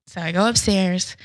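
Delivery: ordinary talk, nothing marked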